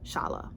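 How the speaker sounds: "Shala" whispered with a soft sh; whispered self-correction. Shown as a demonstration. Reviewer fixing a character's name, whispering. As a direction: breathe "Shala" as a quiet correction. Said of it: "and Chala".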